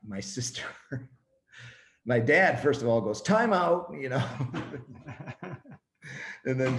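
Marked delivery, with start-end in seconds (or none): 3.2-3.9 high, louder mock call for a halt; imitating a dad stepping in; alarmed interjection. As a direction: cut in loudly, pitched way up. Dad interrupts a marriage proposal.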